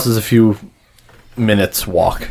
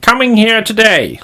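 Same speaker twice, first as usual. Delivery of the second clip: very high-pitched, weird official voice; strange, high gatekeeper voice; weird attendant; 13 semitones higher, 4 dB louder than usual. Acted. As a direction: pipe up high like an odd dockside attendant. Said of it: "they sound weird".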